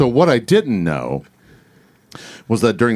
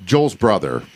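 ordinary talk

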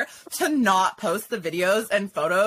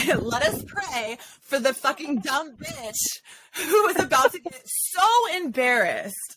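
slow, higher-pitched hammed-up prayer; mock prayer; 5 semitones higher, slower than usual. Mock-praying for the woman about to be embarrassed, mock prayer. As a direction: intone a sham blessing, drawn out.

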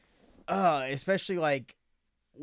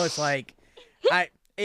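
ordinary talk, nothing marked

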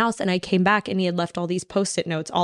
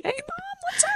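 way higher, soft, sing-song innocence; higher, softer fake-casual greeting to mom; fake innocent; 7 dB quieter than usual. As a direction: sound sweetly innocent while hiding something.

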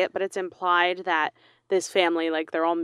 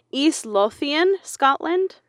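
East Lothian drawn out slowly in a Spanish-sounding accent, higher; odd accent; 6 semitones higher, slower than usual. Real, not acted. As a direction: try a foreign-sounding lilt on Lothian. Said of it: "Why would you give it that accent?".

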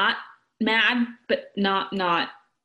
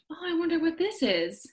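higher, sly, knowing tease; teasing singsong; 7 semitones higher than usual. Retold or imitated. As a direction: dangle the surprise playfully. Husband teasing with hidden gift.

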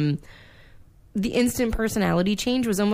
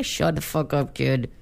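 gruff Max; 5 semitones lower than usual. Pretend. Max snaps at the kid.

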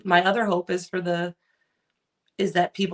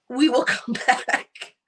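slow, laughing, higher; 4 semitones higher, much slower than usual. Retold or imitated. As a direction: drag it out mockingly with a chuckle. Mocking soft modern riders.

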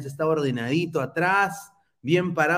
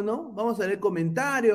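ordinary delivery

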